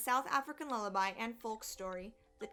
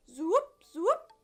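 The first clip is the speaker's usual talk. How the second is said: loud zoop swooping sharply upward; magic wand zoop; 7 semitones higher, 8 dB louder than usual. Acted. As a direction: make things vanish with a rising zoop. Father's magic wand sound effect.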